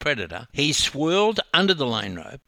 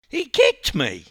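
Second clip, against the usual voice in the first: high, loud wailing insistence; wailing protest; 8 semitones higher, 6 dB louder than usual. A narrator's reading. Wailed complaint repeated to teammate.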